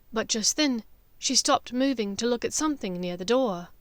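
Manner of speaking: plain reading voice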